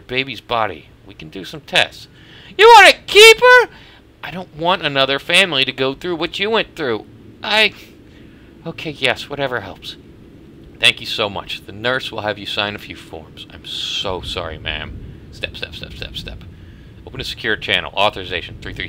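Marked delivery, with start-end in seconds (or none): 2.6-3.7 high, loud horrified question; shocked disbelief. Acted. Mother shocked, voice shown high. 15.3-16.4 voiceless whispered step step. Acted. Whispered footsteps.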